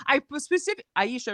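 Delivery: ordinary talk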